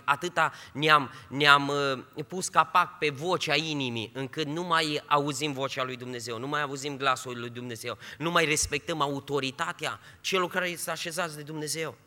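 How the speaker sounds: normal talking voice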